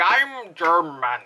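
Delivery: very slow mock German accent; mock a badly faked German accent, drawing out each word; hammed-up German accent, slow. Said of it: "like, German accent. It was just so bad".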